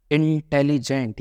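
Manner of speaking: इंटेलिजेंट slowly, in separated chunks: in, telli, jent; broken into syllables. Shown as a demonstration. Teacher showing a triple-syllable word, इंटेलिजेंट slowly broken up. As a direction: draw out इंटेलिजेंट piece by piece. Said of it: "ये triple syllable sound है".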